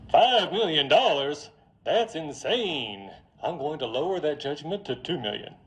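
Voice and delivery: put-on Mississippi accent. Judge lowering the jury's award, put-on Mississippi accent. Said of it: "the judge has the same accent".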